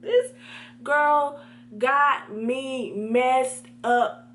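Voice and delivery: very slow, word-by-word indignation; exasperated. Acted. Student to viewers about classmate, slow exasperated declaration. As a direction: drag out each word in acted outrage. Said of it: "And so I'm like".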